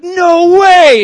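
a fan who can't believe his luck; amazed disbelief. Retold or imitated.

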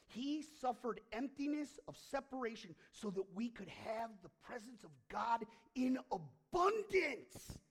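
building to loud, high fervour; preaching climax. Acted. Preacher rousing the congregation, rising to a loud climax. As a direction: swell into full preaching force.

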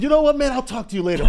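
loud and high, cutting the call short; abrupt brush-off. Retold or imitated. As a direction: hang up on him with a burst. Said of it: "And I said".

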